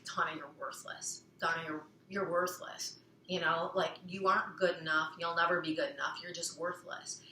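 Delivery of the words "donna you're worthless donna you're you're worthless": a hint of a cold, quieter inner critic; critical inner voice. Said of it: "so you hear that voice".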